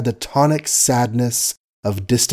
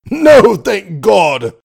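slow, loud and hearty, higher; 8 semitones higher, 7 dB louder, much slower than usual. A narrator's reading. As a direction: boom it out heartily. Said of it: "said the colonel heartily".

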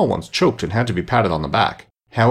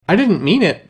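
high, louder protesting plea; pleading; 9 semitones higher, 5 dB louder than usual. A narrator's reading. Alice apologises to offended mouse, pleading. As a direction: plead earnestly, voice lifted. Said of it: "pleaded poor Alice".

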